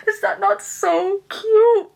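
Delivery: high, slow and squealing with delight; gushing. Real, not acted. Reader gushing to viewers over a sweet passage, high delighted squeal. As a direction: melt over how cute it is.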